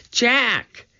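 high, urgent cry of a name; alarmed call. A narrator's reading. Calling to boy knocked into stream.

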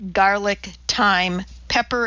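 ordinary delivery, nothing marked

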